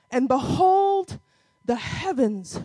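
ordinary talk, nothing marked

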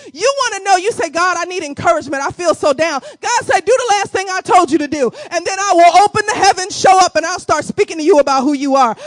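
building revival preaching; rising preaching. Acted. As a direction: drive the point home to the congregation. Preaching at full force.

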